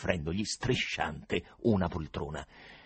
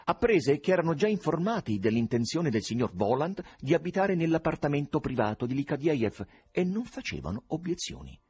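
plain reading voice

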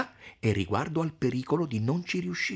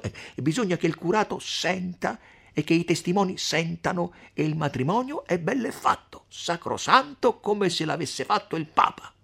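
put-on higher voice of a knowing older woman explaining a trick; shrewd Agnese; 9 semitones higher, 3 dB louder than usual. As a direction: lay out the scheme with relish. Narrator voices Agnese explaining scheme. (Agnese scaltra che spiega il trucco, voce più acuta)

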